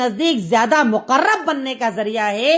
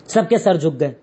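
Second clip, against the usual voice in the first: lower, falling close; low falling repeat; 7 semitones lower than usual. Shown as a demonstration. Teacher to class, repeating the line in a lower, falling voice. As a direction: drop the voice to seal the moment.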